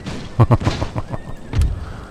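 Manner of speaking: breathy unvoiced chuckle; laugh. Real, not acted. Laughs at his own whispering remark.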